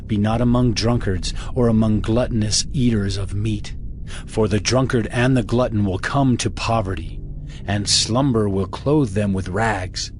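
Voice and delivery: usual speaking voice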